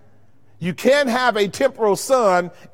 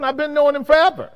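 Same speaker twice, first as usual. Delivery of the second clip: voicing Jesus; 6 semitones higher, faster than usual. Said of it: "That's what Jesus said".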